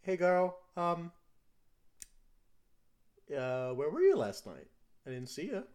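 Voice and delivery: put-on a kid greeting her parent; child character voice.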